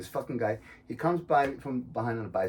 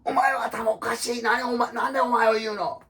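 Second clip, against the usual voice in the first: higher, heated Japanese comeback; cyclist talking back; 12 semitones higher, 5 dB louder than usual. Retold or imitated. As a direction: snap back at him in the street. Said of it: "And he's like".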